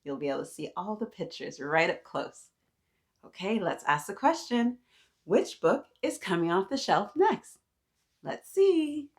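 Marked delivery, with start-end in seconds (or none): none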